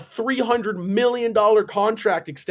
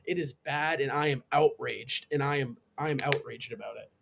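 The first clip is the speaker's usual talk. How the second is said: deadpan outrage; 5 semitones lower, 8 dB quieter than usual. Real, not acted. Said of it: "if you couldn't tell".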